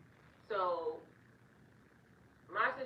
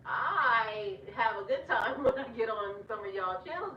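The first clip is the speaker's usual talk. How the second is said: loud, higher, amused laugh in the voice; 3 semitones higher, 6 dB louder than usual. Real, not acted. Telling YouTubers their channels are funny, laughing. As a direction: let real laughter break into it.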